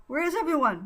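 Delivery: sharp high loud question; snapped. Acted. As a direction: snap it out. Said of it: "Buddy snapped".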